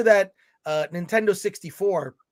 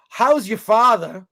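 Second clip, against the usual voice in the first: slow, louder put-on voice; 2 semitones higher, 6 dB louder than usual. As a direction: draw it out loudly in a put-on voice. Reads comment in put-on voice.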